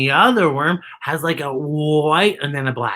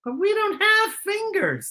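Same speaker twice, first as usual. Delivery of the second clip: puzzled worm; 14 semitones higher, 4 dB quieter, slower than usual. A narrator's reading. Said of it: "says Worm".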